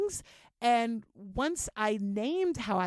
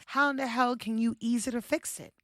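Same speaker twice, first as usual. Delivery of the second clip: ordinary talk, nothing marked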